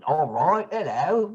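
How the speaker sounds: childhood mock English accent; hammed-up English-accent greeting. Shown as a demonstration. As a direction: put on a playful English accent. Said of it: "I did all kinds of accents".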